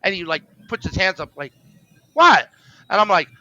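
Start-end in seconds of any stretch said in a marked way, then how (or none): 2.1-2.5 high, loud challenge, imitated; sudden high-pitched retold outburst; mock indignant what. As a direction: act out someone thrown off and demanding an explanation. Retold wrestler throws hands up.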